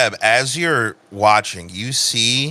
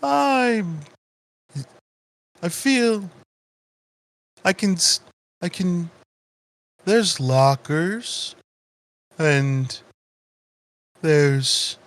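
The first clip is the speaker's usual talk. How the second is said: slow, halting, higher voice; hesitant plant character; 6 semitones higher, slower than usual. Acted. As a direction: feel around for each word with long gaps. GM voices trapped plant groping for words.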